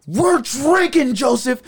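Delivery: high, loud and slow; drunk declaration. Retold or imitated. Eric announcing to Joseph, high, slow and loud. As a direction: stretch it out like a proud drunk.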